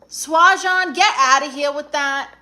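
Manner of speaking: a big, high, teasing get-outta-here; playful loud rebuff. Real, not acted. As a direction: shoo the flirt away loudly. Playfully rebuffing a chat flirt.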